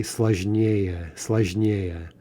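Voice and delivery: "сложнее" said as slozh-NYE-ye, stress on the first е of -ее; stress on the ending. Shown as a demonstration. Teacher demonstrates the expected comparative, stressing the ending of "сложнее". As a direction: lean on не in "сложнее". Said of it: "have stress on the yeah".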